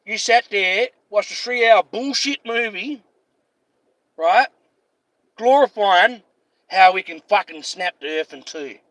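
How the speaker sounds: usual speaking voice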